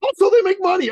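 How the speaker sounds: put-on high, naive young guy; eager trainer voice. Dan telling his coach about doctors, high naive voice. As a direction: voice him high and earnest. Dan adds money reason.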